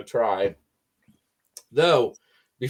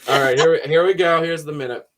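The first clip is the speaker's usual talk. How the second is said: ordinary talk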